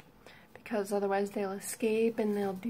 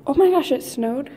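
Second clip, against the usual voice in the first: surprised; 5 semitones higher, 8 dB louder than usual. Real, not acted. Sees snow outside.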